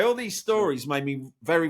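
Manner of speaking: ordinary delivery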